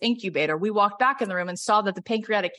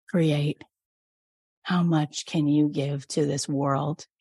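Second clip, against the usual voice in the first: guided meditation; 4 semitones lower than usual. Acted. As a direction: lead them inward with long pauses. Leads a meditation.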